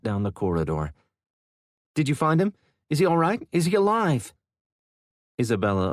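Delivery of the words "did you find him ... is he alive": high, urgent pleading questions; anxious questions. Acted. Boy bursting out to ask about his injured dad, high and anxious. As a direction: rush out the worry for his dad.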